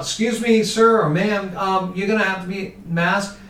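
high, polite store clerk voice; a store clerk stopping a customer at the door. Retold or imitated. Clerk politely stopping an unmasked customer at the door.

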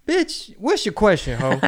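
loud, high-pitched snap back; hammed-up indignant retort; mock exasperated comeback. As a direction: cut off a rambling reporter. Acted comeback to rambling reporter.

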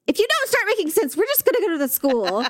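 mock stern mom.

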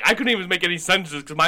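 normal talking voice

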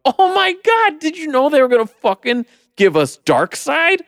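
thrilled gasp. Retold or imitated. Moviegoer reacting to the surprise in the theater, giddy. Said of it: "elation moment in the theater going".

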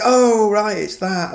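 excited oh right recognition; an eager spot-the-reference aha; recognising. Retold or imitated. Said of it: "you'll be like".

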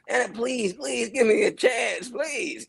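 mocking his old agency pitches, high begging; begging actor. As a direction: beg the agency desperately. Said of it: "going to agencies and going".

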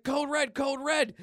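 high, urgent repeated alert; acted emergency cry; alarm call. Everyone calling the alarm.

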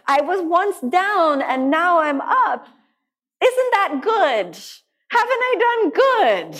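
put-on high, slow, pleading like a small child; childish protest. High, slow childish plea to her teacher. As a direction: whine it up like a proud kid wanting praise. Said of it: "And I said".

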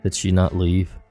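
plain reading voice

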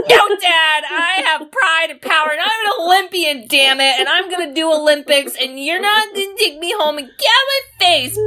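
high-pitched, hammed-up outburst; mock defiance. Podcaster acting Junior standing up to his dad, mock-defiant rant. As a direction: stand up to Dad with theatrical indignation.

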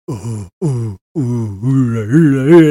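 low, droning "ooh" chant; wordless spooky chanting, mock-ominous; imitated eerie chant. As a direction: mimic cultists chanting from a horror game. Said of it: "I'm hearing the chant".

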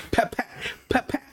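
quick, soft, high "pep" jab noises; jab sounds. Retold or imitated. Describing Creed's jabs, soft quick mock jab noises. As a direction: tap out the jabs with the mouth.

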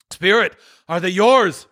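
high, shaken question; appalled Scrooge. Acted. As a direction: ask in horror.

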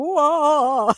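high, loud hammed-up ghost moan; spooky mock whoa. Spirit playing demon for investigators, hammed-up whoa.

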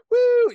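high, loud whoop; Chris Rock. Retold or imitated. Describing a comedian's stage energy, a loud high whoop. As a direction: let out a showman's "woo".

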